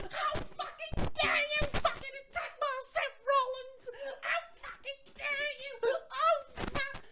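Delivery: hammed-up enraged fangirl scream. Vlogger imitates a furious fangirl, screaming high and loud. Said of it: "you have a fangirl going".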